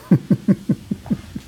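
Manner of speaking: quiet unvoiced chuckle; breathy laugh. Real, not acted. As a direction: laugh softly under your breath. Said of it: "And [name] is laughing".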